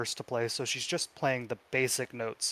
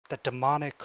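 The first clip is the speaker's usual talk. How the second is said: normal talking voice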